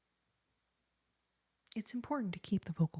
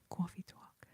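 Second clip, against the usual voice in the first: put-on softer "Coffee talk" in a New York-area accent, cwoffee; 8 dB quieter than usual. Streamer repeating the sketch title to self, New York-area accent. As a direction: quietly echo the sketch host's New York-area vowels. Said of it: "line taken from that Coffee Talk sketch".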